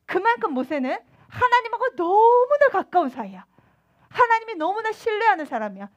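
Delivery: ordinary talk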